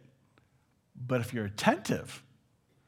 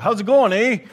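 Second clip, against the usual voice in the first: higher, louder breezy how's it going; careless greeting; 8 semitones higher, 12 dB louder than usual. Retold or imitated. Preacher mocking the careless casual greeting, breezy and loud. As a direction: toss off the greeting without caring.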